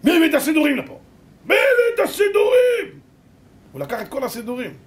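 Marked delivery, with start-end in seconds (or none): 0.0-1.0 high, loud acted SS demand; menacing shouted question; angry interrogation. SS man demands who brought prayerbooks. 1.4-3.0 very high, drawn-out SS scream; full-force acted fury; screamed interrogation. SS man screams the question again.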